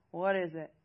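loud, curt, gruff question. A narrator's reading. Maris answering Seth while frustrated with Britt, gruff. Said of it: "Maris said gruffly".

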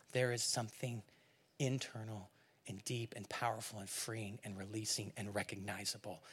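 very soft, low, intimate sermon voice; hushed preaching. Real, not acted. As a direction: bring it right down and let each phrase settle.

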